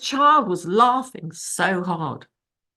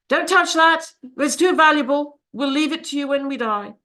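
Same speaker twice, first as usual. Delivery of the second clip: stern parent; 8 semitones higher, 4 dB louder than usual. Retold or imitated. Parents forbidding touching treasures.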